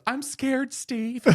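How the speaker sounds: very high, squeaky scared voice; mock-terrified falsetto nephew; frightened sidekick Chad. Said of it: "his go-to phrase was".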